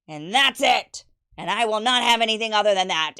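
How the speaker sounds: loud, firm mock-stern father laying down the law; stern father lecture. Dad forbidding his teen daughter from modeling, loud and stern. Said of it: "But I was like".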